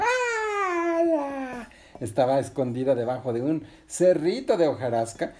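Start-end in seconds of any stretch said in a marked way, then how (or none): 0.0-1.7 high, drawn-out "aah"; long high-pitched playful shout; surprise-appearance cry. Acted. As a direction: jump out with a high squeal. Resbalardilla leaps out at friends.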